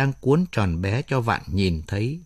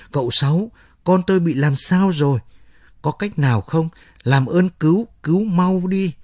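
higher and more urgent, a frightened cry for help; panicked stammering plea; 6 semitones higher than usual. A narrator's reading. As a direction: beg for the baby in rising panic. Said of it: "run rẩy hoảng loạn hét lên lắp bắp". (hoảng loạn van xin lắp bắp)

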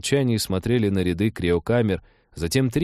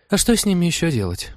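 higher, mildly exasperated query; understated helpless complaint; sighing question; 10 semitones higher than usual. A narrator's reading. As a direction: ask with a resigned sigh. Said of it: "вздохнула Трикси".

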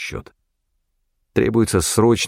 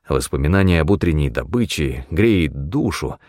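usual speaking voice

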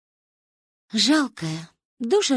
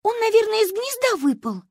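put-on high child voice; high, childish concern over a chick; concerned little girl. A narrator's reading. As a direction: voice a small girl, pitched way up. Child guesses about the fallen chick.

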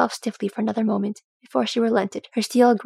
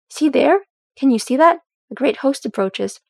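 excited; 4 semitones higher, 5 dB louder than usual. A narrator's reading. Dwalin spots approaching host.

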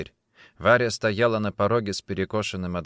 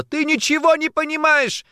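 high, loud, exasperated yell; shouting; 6 dB louder than usual. A narrator's reading. Woman in quarrel with her man, shouting. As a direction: shout back at him. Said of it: "теперь тоже кричала".

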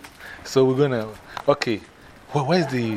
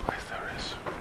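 whisper; 15 dB quieter than usual. Real, not acted. Asking quietly in an office.

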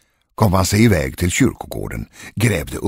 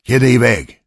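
louder, higher, sharp command; impatient order; 4 semitones higher, 6 dB louder than usual. A narrator's reading. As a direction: send the servant off impatiently. Master orders frightened servant away. (otålig, högljudd befallning)

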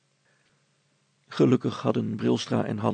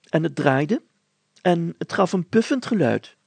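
higher, louder, deliberate village grocer; thoughtful grocer; 5 semitones higher, 7 dB louder than usual. Acted. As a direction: grocer thinking it over aloud. Grocer Van Loon reports.

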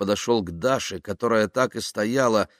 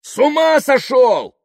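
high, loud, drawn-out outraged shout; yelling; 10 dB louder, slower than usual. A narrator's reading. Yuklin outraged at Kaftanov changing the bed, yelling. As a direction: bellow in disbelief. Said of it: "заорал Юклин".

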